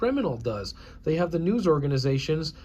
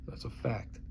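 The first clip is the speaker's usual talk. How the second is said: hushed, breathy aside; whisper; 4 semitones lower, 13 dB quieter than usual. Real, not acted. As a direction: drop to a secret whisper.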